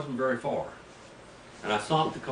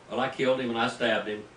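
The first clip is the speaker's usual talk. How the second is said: ordinary talk, nothing marked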